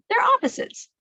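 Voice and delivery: plain reading voice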